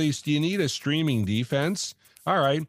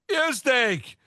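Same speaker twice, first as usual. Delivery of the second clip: high jeering call; mock jeer; 7 semitones higher than usual. Jeering the rookie quarterback, mock disgust. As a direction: boo him like a fed-up fan.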